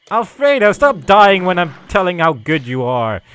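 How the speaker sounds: high, loud burst of exasperation; exasperated scolding. Real, not acted. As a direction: scold your useless minion loudly. Scolds his dying minion.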